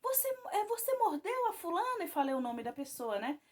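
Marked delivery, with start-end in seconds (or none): 0.0-2.0 high, pressing, desperate question. Retold or imitated. Mother questioning her toddler, desperately high and urgent. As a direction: demand an answer in a panic. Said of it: "no desespero".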